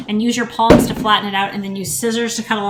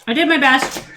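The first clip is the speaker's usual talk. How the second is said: ordinary talk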